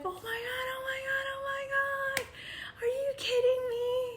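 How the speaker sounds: very high, breathy squeals of disbelief; screaming for joy. Retold or imitated. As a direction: squeal with delight. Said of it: "I screamed".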